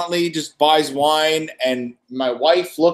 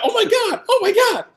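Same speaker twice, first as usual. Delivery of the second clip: mock starstruck fan. Store manager imitating gushing at celebrity, mock starstruck squeal. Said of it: "without being like that".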